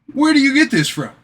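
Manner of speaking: high, loud astonished question; mock amazement. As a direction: ask it amazed at his luck. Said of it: "I'm like".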